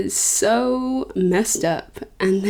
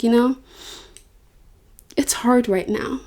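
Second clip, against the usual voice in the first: soft reassurance; 3 semitones higher, 4 dB quieter than usual. Retold or imitated. God comforting her.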